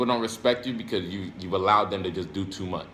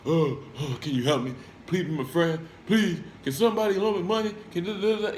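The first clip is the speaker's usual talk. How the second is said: mock pleading, pitched higher, half laughing; desperate beggar; 8 semitones higher than usual. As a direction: mimic a needy person begging for help and money. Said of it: "rather than just being desperate".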